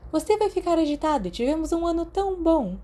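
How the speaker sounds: leve repreensão da enfermeira. A narrator's reading. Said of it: "Madame Pomfrey o repreendeu levemente". (bronca leve de enfermeira, voz mais aguda)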